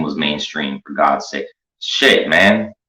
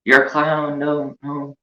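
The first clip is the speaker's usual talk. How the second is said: high, shrinking pleas fading away; mock scared; 8 semitones higher, slower than usual. Pretending to cringe away from the creepy clown behind him. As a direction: back off from the creep, half-joking. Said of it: "And it's just like".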